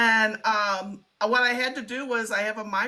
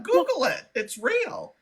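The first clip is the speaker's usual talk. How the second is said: emphatic; 8 semitones higher, slower than usual. Retold or imitated. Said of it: "'cause I tell them".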